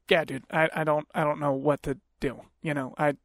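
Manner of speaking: low, soft, halting mumble; resigned husband. Retold or imitated. Husband to other guy about his wife, low resigned voice. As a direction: imitate a husband giving up, quietly.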